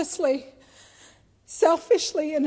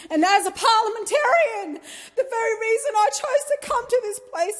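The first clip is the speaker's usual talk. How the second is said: composure breaking; 7 semitones higher than usual. Real, not acted. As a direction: voice cracking with tears. Grieving aunt's speech, voice cracks.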